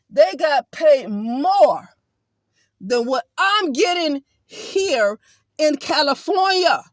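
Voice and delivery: indignant near-shout about the pay; outraged emphasis. Real, not acted. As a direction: hammer it home in disbelief.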